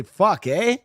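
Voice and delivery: loud Alberta accent lifting up at the end; playful Alberta accent demo, upward inflection. Shown as a demonstration. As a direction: do an Alberta accent, eh rising high. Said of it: "upward inflection".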